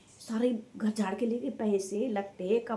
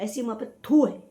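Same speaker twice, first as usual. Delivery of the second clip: real scorn, थू punched much louder; spat-out disgust; 3 semitones higher, 10 dB louder than usual. Real, not acted. Vlogger condemning a mother who betrayed her daughter, disgusted. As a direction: burst out loud on थू in contempt.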